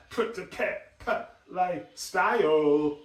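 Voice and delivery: mumbled, slurred words; mumbling demo. Shown as a demonstration. Coach showing a mumbler, slow unvoiced mumble. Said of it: "What are you saying?".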